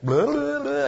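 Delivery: high, loud mocking blah blah; dismissive blah blah. Real, not acted. Preacher brushing off a lunatic's claims, high and loud. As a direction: wave it off like endless nonsense. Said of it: "dismissive filler in argument".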